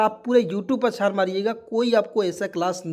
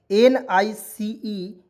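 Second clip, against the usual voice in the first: slow spelling; 2 semitones higher, 3 dB louder, much slower than usual. Shown as a demonstration. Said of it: "teacher spells out NICE".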